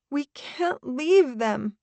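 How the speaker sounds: slow, high, small voice; small and lost. Acted. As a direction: plead helplessly, sounding lost. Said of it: "my voice small and lost".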